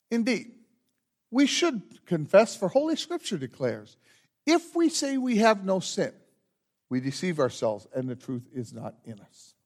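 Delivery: measured, solemn liturgy voice; liturgical leading. Acted. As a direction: lead the congregation through the rite. Pastor leads confession and absolution liturgy.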